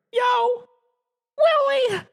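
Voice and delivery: high, loud happy greeting; excited alien cry. A narrator's reading. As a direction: cry out with delight. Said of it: "cried Alf".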